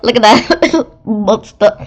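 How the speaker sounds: laughing through the words; can't stop giggling. Real, not acted. Narrator laughing while reading about the monster.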